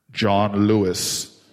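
very low, slow, loud John Lewis; grand dramatic reveal voice; deep booming announcer. Retold or imitated. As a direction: boom the name like a movie trailer. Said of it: "And Skip goes".

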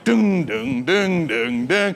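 mock dramatic drum beats; dramatic drumbeat sounds; drum imitation. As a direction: thump out drums with your voice. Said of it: "there were drums like".

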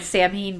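Samhain said "SAM-heen"; habitual mispronunciation. Shown as a demonstration. Co-host admitting years of saying it wrong, Samhain pronounced "SAM-heen". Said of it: "although I've said Samhain for years".

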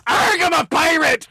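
high-pitched, drawn-out pirate yell; yelled pirate line. Retold or imitated. Comic retelling of blurting at an audition, yelled pirate line. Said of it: "you fucking just yelled".

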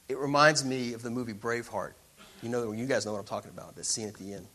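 ordinary talk

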